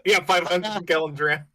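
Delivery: high, loud, through real laughter; amused, laugh-filled voice. Real, not acted. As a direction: repeat it while cracking up. Cracking up at the absurd drum.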